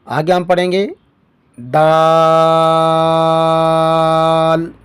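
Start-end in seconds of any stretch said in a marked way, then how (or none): 1.6-4.7 دال with the vowel held very long; دال recited with a drawn-out madd. Shown as a demonstration. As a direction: stretch the vowel in دال slowly. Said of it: "اس کو بھی ہم لمبا کریں گے".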